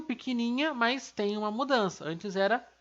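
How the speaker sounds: normal talking voice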